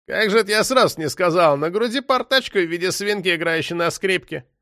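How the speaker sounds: high-pitched facetious patter; smirking, sing-song mock helpfulness; mocking detainee. A narrator's reading. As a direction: feign sudden recall to taunt the police.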